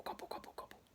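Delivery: soft breathy gobble noises; whispered gobbling. Acted. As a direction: gobble it under your breath like a greedy eater. Gobbling sound of eating.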